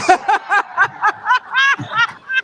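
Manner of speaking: loud rising laugh at his own joke; big laugh. Real, not acted. Alligator handler laughing at his own joke. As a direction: crack up at the millennial joke.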